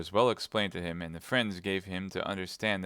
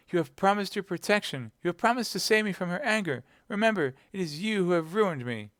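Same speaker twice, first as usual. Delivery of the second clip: put-on high-pitched frightened young woman; distressed cry; 11 semitones higher, 3 dB louder than usual. A narrator's reading. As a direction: plead in a high, agitated voice. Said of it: "all in a tremble".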